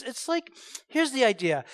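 usual speaking voice

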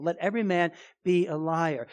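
ordinary talk

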